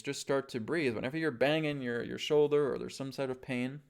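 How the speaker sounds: ordinary talk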